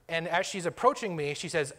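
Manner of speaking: normal talking voice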